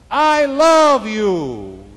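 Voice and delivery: loud, high, drawn-out holler; screamed out. Acted. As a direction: call it slowly across the wilderness. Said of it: "He screamed out".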